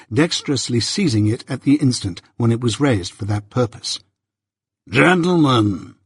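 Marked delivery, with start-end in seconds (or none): 4.9-5.9 slow, deliberate call to order; stern lawyer. A narrator's reading. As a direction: halt the quarrel with one measured word. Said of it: "said Mister Jaggers".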